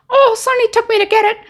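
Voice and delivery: high, squeaky put-on voice; mock girlfriend voice. Girlfriend explaining her dress to dad, mock high voice.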